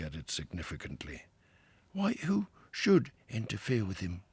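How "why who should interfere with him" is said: higher, wondering Mole voice; a newcomer seeing the river for the first time; Mole, awed and a little breathless; wide-eyed Mole. A narrator's reading. Mole puzzled.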